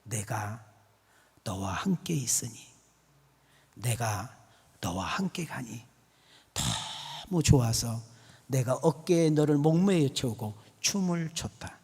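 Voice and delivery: soft, low, slow-paced divine reply; hushed voice of the Lord answering; the Lord's answer. Acted. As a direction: speak low and hushed, with pauses between phrases. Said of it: "우리 주님 하는 말이".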